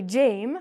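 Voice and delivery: "Jame" with a long A, like James without the S; wrong long A. Shown as a demonstration. Teacher shows what we don't say for jam, long A. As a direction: say "Jame" with the letter-name A, as the mistake. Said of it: "We don't say".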